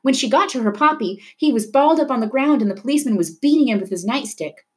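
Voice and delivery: ordinary delivery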